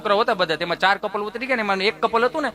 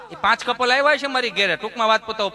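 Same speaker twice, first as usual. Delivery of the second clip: ordinary talk, nothing marked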